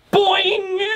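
high, springy mock boing; high cartoon boing. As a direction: bounce the word up high. Bounce sound effect.

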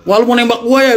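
high teasing squeal. Retold or imitated. Retno teasing the boy who asked her to meet, high squeal. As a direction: squeal it out so everyone hears. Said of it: "dia ngomong nadanya tinggi".

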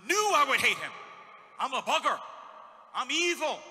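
loud, surging sermon shout; preaching at full force. Acted. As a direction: preach it out to the back pews.

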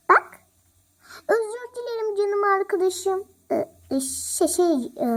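usual speaking voice

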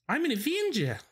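put-on high, squeaky Kiwi accent; hammed-up New Zealand voice. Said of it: "my bad Kiwi accent".

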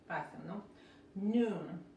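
ordinary talk, nothing marked

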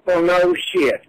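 ordinary talk

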